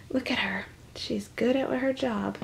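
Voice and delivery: breathy secret aside; whisper. Real, not acted. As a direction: lean in and whisper it. Reader whispers aside to kids.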